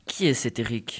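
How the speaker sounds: low, soft wondering to oneself; pensée à voix basse. A narrator's reading. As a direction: ask it privately, under the voice. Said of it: "se demanda-t-il". (à voix basse, pour soi-même)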